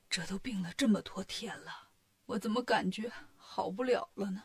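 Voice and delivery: sick monk fearing he won't recover, whispering weakly. Acted. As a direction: barely get the words out, ill in bed.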